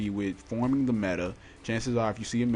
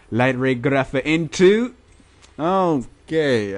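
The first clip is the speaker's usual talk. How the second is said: excited; 4 semitones higher, 10 dB louder, slower than usual. Real, not acted. Big card pulled from pack.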